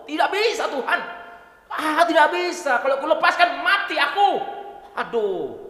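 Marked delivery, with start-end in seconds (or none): 0.1-4.4 put-on high, loud Batak-accented refusal, laughing. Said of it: "gaya Batak ini ngomongnya". (menolak dengan logat Batak, tertawa)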